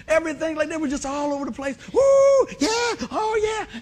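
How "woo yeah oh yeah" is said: very high, loud whooping cheer; mock crowd cheer. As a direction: whoop it like a fan at the game. Imitating cheering football crowd.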